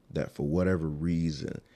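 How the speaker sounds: usual speaking voice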